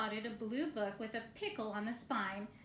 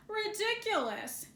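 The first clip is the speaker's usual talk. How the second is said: scoffing; 9 semitones higher, 4 dB louder than usual. Acted. Bear scoffing at rocket ships.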